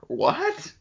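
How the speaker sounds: high, disbelieving "what"; sharp, incredulous question. Real, not acted. Disbelief at the cops.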